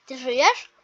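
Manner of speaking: usual speaking voice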